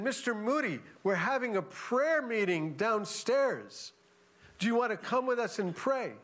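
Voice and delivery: lightly voiced eager believers asking him to pray; passengers' invitation.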